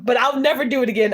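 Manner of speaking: normal talking voice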